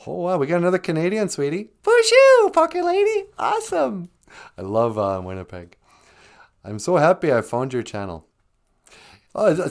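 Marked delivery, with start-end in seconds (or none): 1.8-4.1 very high, louder hello; squeaky puppet greeting. Pretend. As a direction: pipe up like a tiny puppet.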